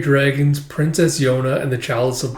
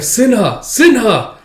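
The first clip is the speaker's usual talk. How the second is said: high-pitched cry of a name; alarmed call; 10 semitones higher, 5 dB louder, slower than usual. Acted. Fighter calling to a comrade among soldiers, alarmed cry.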